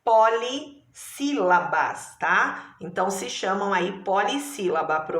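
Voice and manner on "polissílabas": polissílabas drawn out slowly, each syllable clear; slow key term. Shown as a demonstration. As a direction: teach the new word slowly. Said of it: "quando ela tem quatro ou mais sílabas".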